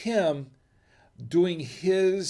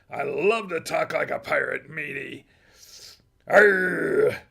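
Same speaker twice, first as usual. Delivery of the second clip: hammed-up movie pirate; growling pirate boast; 5 dB louder, slower than usual. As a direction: relish it like an old sea dog. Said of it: "that was his voice that everybody's tried to emulate".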